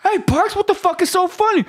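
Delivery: high yelling drill instructor. Retold or imitated. Drill instructor catching him smiling, high yell. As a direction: bark it through laughter. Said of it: "He was like".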